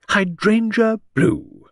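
slow, high and dreamy; rapt voice. Acted. Lucy dreamily announcing her outfit, rapt and slow. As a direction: savour each word in a rapture. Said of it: "announced Lady Angertel in a rapt voice".

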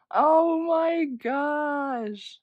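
loud, slow, high-pitched gush; excited squeal. Real, not acted. Fan gushing over cute merch, excited squeal. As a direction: stretch it out in real fan delight.

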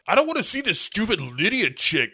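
imitated petulant gripe, starting high; bitching kid. Child complaining to guardian about losing robot, mock bitching. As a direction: act out a sulky kid's complaint. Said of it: "Katie is bitching about having Megan".

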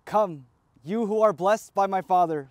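ordinary talk, nothing marked

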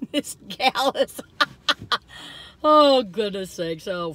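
slow words through laughter; laughing. Real, not acted. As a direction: laugh helplessly while talking. Laughing at the shaking car.

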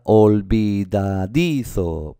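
olvidadizo slow by syllables, DI held; sounded out. Shown as a demonstration. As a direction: stretch olvidadizo, leaning on DI. Teacher sounds out the word.

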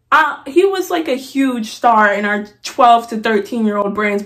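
usual speaking voice

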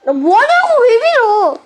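high, loud indignant yell; outraged shout. Real, not acted. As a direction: protest loudly at being pushed. Outraged at being pushed.

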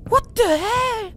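high, slow startled cry; stunned high outcry; shocked cry. Real, not acted. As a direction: let the fright still ring in it. After jump scare.

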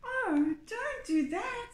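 high, pleading small goat voice; pleading little goat. A narrator's reading. Little goat begging the troll, high pleading voice.

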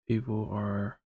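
ordinary talk, nothing marked

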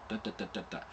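soft da da da showing the jaw; quiet syllable demo. Shown as a demonstration. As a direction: tap out da, da, da quietly. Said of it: "one rotation axis on the jaw".

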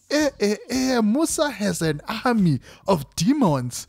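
mock-invoke divine wrath in a put-on voice; put-on dramatic voice. YouTuber mocking a tweet about an army of demons, in a hammed-up put-on voice. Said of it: "Why am I talking in that voice?".

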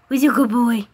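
eager cooing at a pet; excited pet talk. Real, not acted.

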